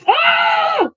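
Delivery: loud high mocking scream; hammed-up shriek of fury; mock rage scream. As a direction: screech like someone losing it. Mocking the caller's crash out.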